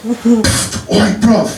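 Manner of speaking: put-on a light London street accent from Croydon; a small voice calling in a lightly done Croydon accent. Woman in the club calling him over, lightly done Croydon accent. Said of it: "She talks like that 'cause she's from Croydon".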